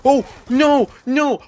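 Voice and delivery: high, loud cries of dismay; anguished shout. Real, not acted. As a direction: yell no in frustration. Dies one bullet short.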